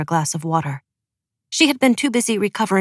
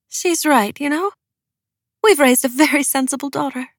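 high, proud mother's voice; smiling mother; 8 semitones higher than usual. A narrator's reading. Mother backing her daughter to her husband, smiling. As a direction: pitch it well up, with a smile.

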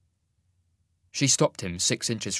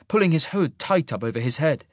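usual speaking voice

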